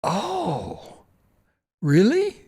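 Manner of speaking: high, mock-amazed oh really; surprised. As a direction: sound suddenly astonished. Ladies' surprised reaction retold.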